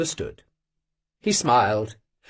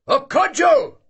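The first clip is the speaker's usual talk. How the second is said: high, loud rousing call; old hunter's shout; 11 semitones higher, 3 dB louder, slower than usual. Acted. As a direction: shout to wake the house. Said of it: "he shouted in his deep voice".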